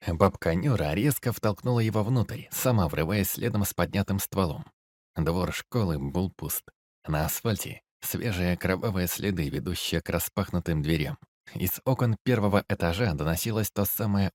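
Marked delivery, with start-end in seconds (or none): none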